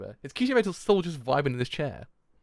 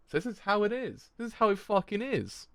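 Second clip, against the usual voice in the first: stunned realisation; 8 semitones higher, 3 dB quieter than usual. Real, not acted. Stunned by the ending.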